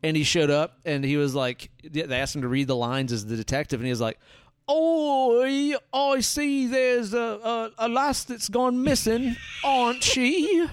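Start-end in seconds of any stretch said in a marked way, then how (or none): 4.7-10.7 very high, slow, exaggerated British accent. Actor reading the detective's lines, hammed-up British accent. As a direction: stretch out a silly British detective voice, pitched way up. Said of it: "he was still in the accent".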